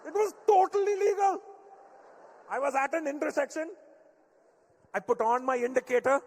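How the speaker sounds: dad asked if it was legal, shouting; outraged Indian dad. Retold or imitated. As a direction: protest your innocence indignantly, Indian accent. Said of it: "get an Indian upset".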